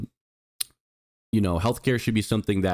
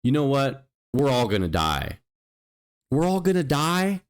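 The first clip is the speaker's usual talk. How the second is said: mocking senator; 5 semitones higher than usual. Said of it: "And then she goes".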